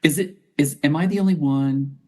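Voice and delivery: ordinary talk, nothing marked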